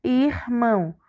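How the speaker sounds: slow, two separate syllables; split into syllables. Shown as a demonstration. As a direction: part "irmão" between R and M. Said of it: "O R ficou na primeira sílaba". (devagar, separando as sílabas)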